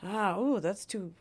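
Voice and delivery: ordinary talk, nothing marked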